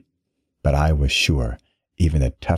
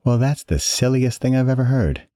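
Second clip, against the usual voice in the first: amused; 9 semitones higher than usual. A narrator's reading. His mood lifts, amused.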